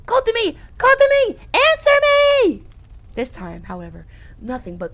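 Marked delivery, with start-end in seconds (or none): 0.1-2.6 high, loud desperate calls; desperate shouting. Acted. Saturday begging Kotomi to answer, desperate shouting. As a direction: call out, louder each time.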